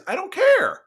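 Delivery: high-pitched, incredulous brush-off; exasperated dismissal. Real, not acted. Host dismissing complaints about short ad breaks, high-pitched exasperation. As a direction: pitch leaping way up.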